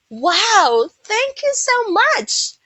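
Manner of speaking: high, drawn-out gushing gratitude; delighted thanks. Acted. Receiver thanks the giver of a present, delighted. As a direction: gush with a big high-pitched thank-you.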